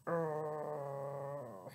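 low, soft growl; grumpy bear growling at the bunny. Acted. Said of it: "Bruce is like".

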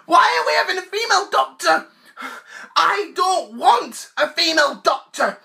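high, fast, hammed-up tearful whine; mock crying complaint. Fan fake-crying about the female Doctor. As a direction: whimper it like a sulking child.

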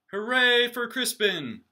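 slow, loud, high hooray; cheering shout. Acted. Crowd cheering for Crispin, loud and drawn out. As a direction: cheer it out for the hero. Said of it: "everyone shouted".

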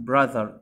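"brother" with a short UH instead of O; silent O. Shown as a demonstration. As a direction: say "brother" as "bra-ther", no O sound. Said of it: "الأو ما بنطقهاش هنا". ("brother" من غير نطق الأو)

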